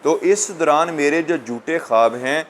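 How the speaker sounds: ordinary delivery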